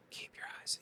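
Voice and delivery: unvoiced whisper, no voice. Paramedic's shout heard as a whisper. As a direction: breathe it without voice. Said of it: "I just hear".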